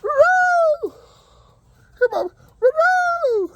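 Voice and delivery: high, loud sing-song "Roo-roo"; high chicken call. Real, not acted. Calling the chickens in.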